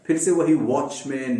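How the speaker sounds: normal talking voice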